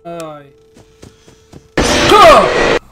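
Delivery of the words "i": sudden yelp of fright; startled scream. Real, not acted. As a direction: jump scare yelp. Jumpscare scream.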